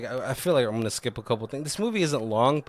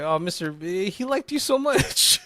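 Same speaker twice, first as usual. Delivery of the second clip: hammed-up high-pitched wail of grief; mock lament; 8 semitones higher than usual. Podcaster mourning a murdered movie character, mock tearful wail. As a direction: whine it like a heartbroken fan.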